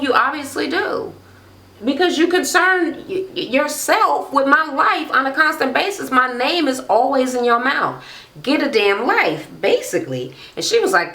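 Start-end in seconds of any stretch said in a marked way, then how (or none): 0.0-9.5 high-pitched mock telling-off; indignant imitation, pitched up; catty clapback. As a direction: act out a heated comeback. Acting out reality-show quarrel.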